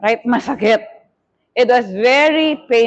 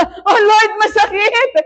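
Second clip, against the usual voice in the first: mock pained cry; 8 semitones higher, slower than usual. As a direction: re-enact a pained plea to God. Repeats her cry from surgery.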